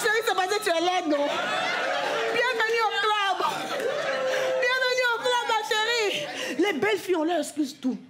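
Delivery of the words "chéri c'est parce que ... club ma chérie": high, teasing welcome; mock welcome. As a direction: sarcastically welcome her in.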